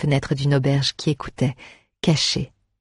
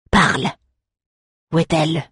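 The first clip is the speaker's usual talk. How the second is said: angry demand; 3 semitones higher than usual. Acted. Undead demands.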